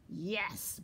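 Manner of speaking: bear's restrained growled yes; growling bear. A narrator's reading. Bear answers the teasing squirrel, lightly growled. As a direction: begin a threat as an angry bear. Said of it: "Bear growled".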